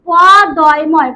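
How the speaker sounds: পদয়ময় drawn out, letter by letter; spelling aloud slowly. Shown as a demonstration. Teacher to students, spelling the word: slow letter by letter. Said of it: "বানানটা হয় কি".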